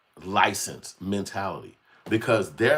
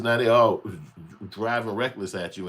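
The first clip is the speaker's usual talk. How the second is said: ordinary talk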